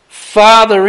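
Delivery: Father cried out high and loud; loud cry. Acted. Jesus on the cross calls out to his Father, loud cry. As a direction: call out to the Father with full voice. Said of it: "in a loud voice".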